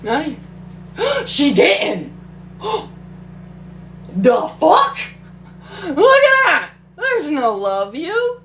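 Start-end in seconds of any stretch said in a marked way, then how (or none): 0.5-1.2 sharp high gasp of shock; real shocked gasp. Real, not acted. Gasp at missing line. 2.2-4.1 long unvoiced gasp; soft shocked gasp; breathy gasp. Real, not acted. Second gasp. 4.2-6.7 high, loud outraged cry; real indignant shout; outraged yell. Real, not acted. Outraged at book.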